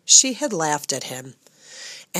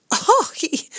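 high squeal of amused disbelief; amused surprise; 14 semitones higher than usual. A narrator's reading. Cook hearing the mouse's quest, high amused oh. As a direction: burst out in amazed delight.

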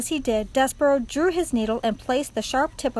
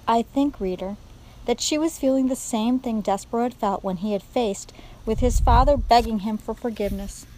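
usual speaking voice

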